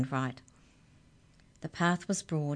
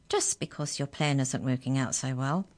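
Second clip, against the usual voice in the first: usual speaking voice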